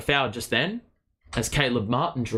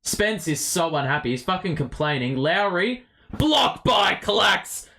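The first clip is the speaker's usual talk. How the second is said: usual speaking voice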